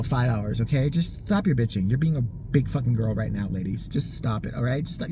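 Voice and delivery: ordinary talk, nothing marked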